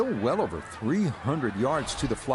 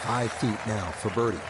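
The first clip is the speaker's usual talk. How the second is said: golf commentator. Real, not acted. Live golf play-by-play.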